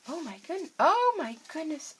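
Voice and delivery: excited squeal. Real, not acted. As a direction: gush with delight over the kit. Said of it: "Oh, I'm so excited".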